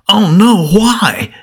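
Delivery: high, anguished "oh no, why"; a grieving disciple's cry, lightly acted; mild lament from a man who just lost his teacher. A narrator's reading. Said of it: "his heart lamented".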